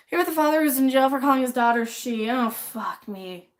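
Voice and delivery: ordinary talk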